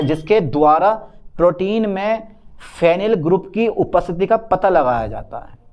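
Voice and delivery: normal talking voice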